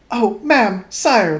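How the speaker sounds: high, loud flustered gasp; a panicked maid blurting it out; gasping housemaid. Acted. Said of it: "the housemaid gasped".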